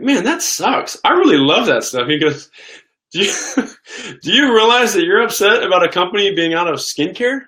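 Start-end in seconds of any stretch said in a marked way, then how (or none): 0.0-2.1 high-pitched complaint, playfully upset; retold upset, pitch jumping up; mock upset. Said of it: "you really seem upset about that". 3.1-7.4 laughing through a high-pitched question; high, incredulous, breaking into a laugh; amused disbelief. Retold or imitated. Friend teases him, laughing.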